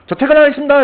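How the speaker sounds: very high, loud sing-song leaving; breezily clock out in front of the boss; cheeky announcement. Retold or imitated. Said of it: "이러면서 가는 거야". (사장 앞에서 높고 크게 얄밉게 퇴근 인사)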